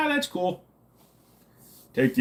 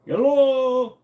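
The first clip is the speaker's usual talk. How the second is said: loud hello; 9 semitones higher, 5 dB louder than usual. Real, not acted. Calling out.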